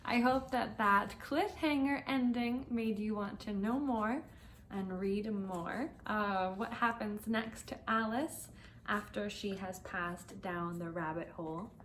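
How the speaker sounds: ordinary delivery, nothing marked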